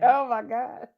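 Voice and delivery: high, loud laughing. Real, not acted. Laughs at old-lady voice.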